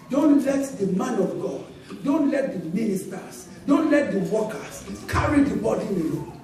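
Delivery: ordinary talk, nothing marked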